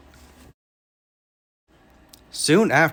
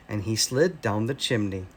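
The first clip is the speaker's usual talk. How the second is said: ordinary delivery, nothing marked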